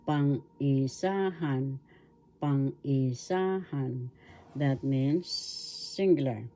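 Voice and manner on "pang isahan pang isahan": "pang-isahan" slowly, pang-i-SAAA-han; stress on third syllable. Shown as a demonstration. Teacher showing where the accent is, slow "pang-isahan" stressed on SA. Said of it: "The accent is in the".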